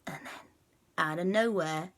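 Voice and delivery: plain reading voice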